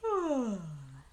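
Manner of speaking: long high yawn; yawning sigh. Acted. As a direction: stretch out a yawn.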